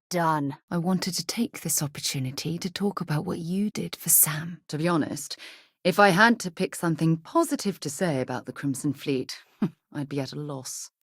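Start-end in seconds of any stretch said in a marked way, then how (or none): none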